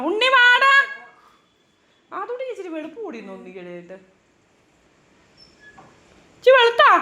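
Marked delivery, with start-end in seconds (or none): 0.1-0.7 calling out. Real, not acted. Calling the boy over. 6.4-6.9 high, loud amazed question; surprised exclaim. Real, not acted. Surprised at baby looking fair.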